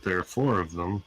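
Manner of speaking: normal talking voice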